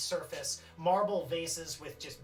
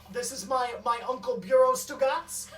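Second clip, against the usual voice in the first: higher, louder boastful intro; proud introduction; 7 semitones higher, 9 dB louder than usual. Acted. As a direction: announce the uncle grandly. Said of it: "He goes".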